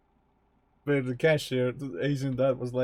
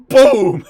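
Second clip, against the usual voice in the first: loud shouted boom sound effect; cartoon explosion yelled into the mic; 5 semitones higher, 14 dB louder than usual. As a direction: blast it out like a tank shot. Tank arrives in imagined ending.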